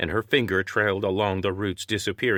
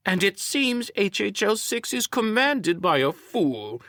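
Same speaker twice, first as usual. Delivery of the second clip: high, mocking antagonist's voice; high-pitched smug jab; 13 semitones higher than usual. A narrator's reading. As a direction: deliver the insult with lofty scorn.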